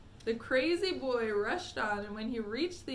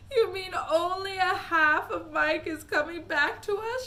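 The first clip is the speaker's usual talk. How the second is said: higher, louder cry of horror; 8 semitones higher, 9 dB louder than usual. Acted. Mother cries out that only half of Mike returns. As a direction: break into a horrified cry. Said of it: "she cried".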